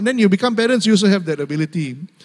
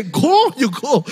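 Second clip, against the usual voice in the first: sharply higher-pitched mock consent; wife-like mock permission; 7 semitones higher, slower than usual. God telling the prophet to go like a wife, mock permission. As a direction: imitate a grudging go-ahead, pitched high. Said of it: "he's like the wife".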